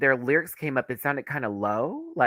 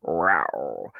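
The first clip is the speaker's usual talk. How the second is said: low, drawn-out "rraow"; imitated cat yowl; 10 semitones lower than usual. Vlogger voicing the thought in their head, playful cat yowl. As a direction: growl it out like a cat. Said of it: "I kept thinking in my head".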